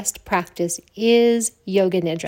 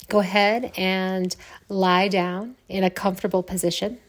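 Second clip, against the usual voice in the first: guided meditation. Acted. Yoga teacher settling listeners to lie down, guided meditation. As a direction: ease them gently into rest, with long pauses.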